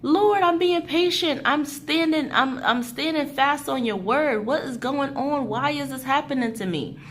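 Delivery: exasperated plea. Retold or imitated. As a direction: cry out to God in disbelief. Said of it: "something that's just like".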